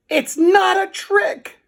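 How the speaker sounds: high, loud, slow indignant shout; cried out. A narrator's reading. Melvin shouts back at the doubting boys, high and indignant. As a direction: yell it in protest, slow. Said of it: "cried Melvin".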